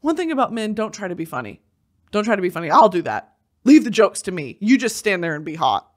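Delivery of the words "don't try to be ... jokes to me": usual speaking voice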